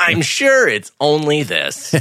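slow, high, smug mock reassurance. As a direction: drag it out like a clueless official. Mocking dismissive reassurance.

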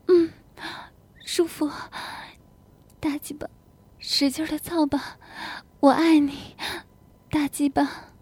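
high, yielding murmurs; aroused reply. Acted. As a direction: answer between heavy breaths. Wife answering during lovemaking.

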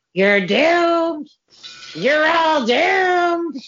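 high, loud doom-prophet wail; slow ominous raspy drawl. Retold or imitated. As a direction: cry out a warning of doom. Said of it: "the old guy's like".

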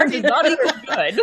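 giggling the words out; laughing hard at a friend's roleplay. Real, not acted. Player cracking up at table.

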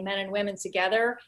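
normal talking voice

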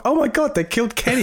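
high-pitched cartoon outcry; mock horror in a high cartoon voice; South Park catchphrase. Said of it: "it's like a South Park".

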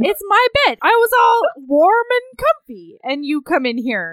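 high, loud hammed-up male voice; mock outraged boyfriend; mock sleepy man. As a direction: whine it like a grumpy guy defending his bed. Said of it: "And he's like".

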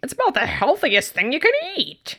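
hint of the Superstore squeak; mild squeaky impression; lightly squeaky voice guy. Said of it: "squeaky voice guy from Superstore".

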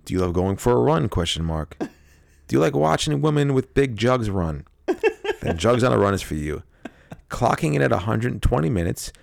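hammed-up commercial read; announcer-style ad voice; mock ad read. Reads a mock ad.

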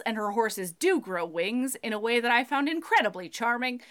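ordinary delivery

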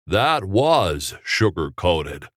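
very slow, deadpan delivery; slow dry line. Acted. Jimmy answers Dorothy's joke, slow and deadpan. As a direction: drag it out dryly.

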